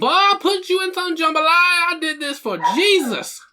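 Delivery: very high, slow falsetto big mama; hammed-up Southern mama with a gun, up in falsetto; slow, sky-high mock church mother. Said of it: "Big Mama".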